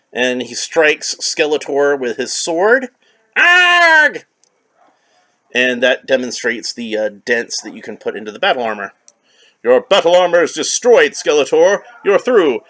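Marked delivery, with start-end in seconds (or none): 3.4-4.2 high loud villain scream of pain. Acted. Skeletor struck by sword. 9.6-12.6 hero's victory line. Acted. He-Man declares victory.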